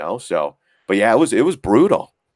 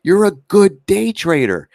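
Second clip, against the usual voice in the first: higher, louder, slow, exasperated scolding; exasperated outburst; 8 semitones higher, 4 dB louder, slower than usual. Retold or imitated. Prop trader scolding the guy next to him, loud and exasperated. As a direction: chew out the reckless trader. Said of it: "he turned to this guy and said".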